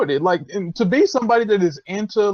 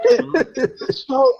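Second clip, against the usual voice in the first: laughing; 9 semitones higher, 4 dB louder than usual. Real, not acted. Still laughing at own joke.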